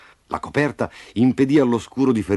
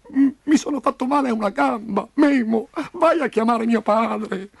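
put-on high, tearful child's wail; crying; 12 semitones higher than usual. A narrator's reading. Injured Roby sending Memo for his father, crying. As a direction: whimper the plea, stumbling at the start. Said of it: "Lo Scuro stava piangendo di nuovo".

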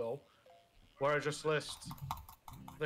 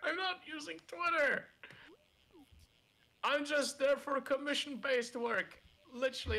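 indignant protest; 12 semitones higher than usual. Real, not acted. Defensive indignant reply.

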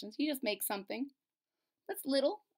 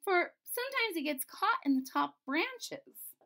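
cartoon princess; 8 semitones higher than usual. Princess voiced.

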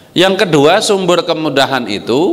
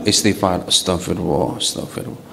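fading to a bare whisper; angel whispering; 8 semitones lower, 6 dB quieter than usual. Acted. Guardian angel whispering repentance to the man. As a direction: breathe the astagfirullah almost silently. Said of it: "Si malaikat yang nolongin kita ngomong".

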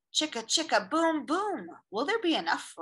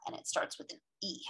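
whispering; 4 semitones lower, 10 dB quieter, faster than usual. Real, not acted. Whispered aside.

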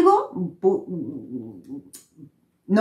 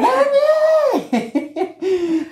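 imitated yell, far higher and long drawn out; grito imitado. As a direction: holler the call in a high mock-frantic voice. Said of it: "y me grita". (grito agudo y alargado)

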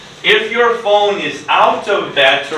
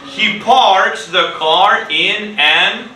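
slow read-along; 3 semitones higher, slower than usual. Shown as a demonstration. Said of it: "Teacher [name] reads. When Teacher [name] stops, you read".

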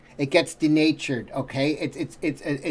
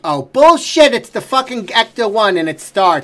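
much louder and higher, calling bullshit; exasperated shout; 4 semitones higher, 8 dB louder than usual. Real, not acted. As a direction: yell at the screen in disbelief.

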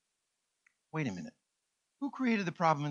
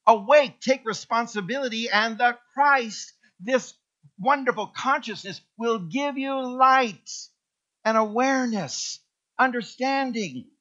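preaching fervour; 5 semitones higher, 5 dB louder than usual. Acted. Preaching builds again.

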